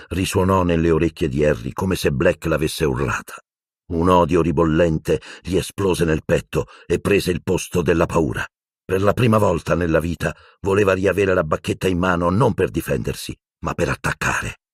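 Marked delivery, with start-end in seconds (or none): none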